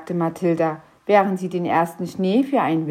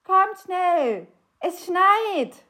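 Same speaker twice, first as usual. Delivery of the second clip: high, loud call drawn out on Mathilda; calling the kids in from another room; calling from the kitchen; 9 semitones higher, 4 dB louder, slower than usual. A narrator's reading. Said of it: "rief es aus der Küche". (lauter Ruf aus der Küche)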